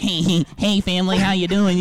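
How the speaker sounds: hammed-up cheery nerd greeting; nerdy son greeting. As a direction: bounce in like an eager nerdy son. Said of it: "I'm like".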